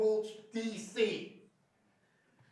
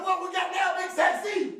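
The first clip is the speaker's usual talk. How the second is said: higher, louder gloating call-out; triumphant taunt; 7 semitones higher, 4 dB louder than usual. Retold or imitated. To the big technician after switching his meter, loud gloating tease. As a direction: crow it like you just won.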